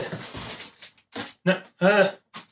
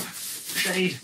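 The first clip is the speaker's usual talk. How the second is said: ordinary delivery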